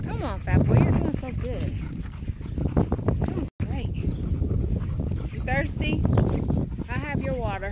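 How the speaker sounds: high, loud cheering on; encouraging the dog. Real, not acted. Mom cheering her dog on the walk, high and loud.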